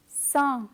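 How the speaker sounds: sa with a French nasal vowel; nasal syllable. Shown as a demonstration. Teacher adds S to the sound, nasal French sa. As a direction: let the vowel of sa ring through the nose. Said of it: "when you add an S".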